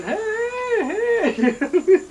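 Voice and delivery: a high calling hey, hey; hollering for the lost kids. Retold or imitated. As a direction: call out across the mountain. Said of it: "we're looking around hollering".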